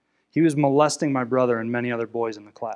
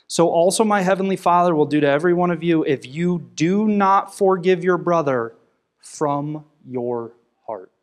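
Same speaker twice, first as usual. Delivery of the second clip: louder, emphatic reading that slows to a halt on the last words; scripture reading; 4 semitones higher, 5 dB louder than usual. Acted. Pastor reading Matthew to the congregation, loud and emphatic. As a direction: proclaim the verse to the congregation.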